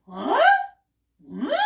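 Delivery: puzzled dog noise, rising sharply; short questioning grunt that swoops up; confused cartoon-dog "huh?"; Scooby-Doo. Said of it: "Scooby-Doo's always going".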